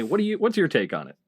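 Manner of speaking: ordinary delivery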